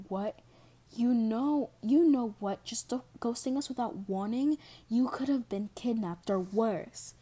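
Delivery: loud angry rant, high and strained; screaming. A narrator's reading. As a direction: scream at her, scared and furious. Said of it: "His screams".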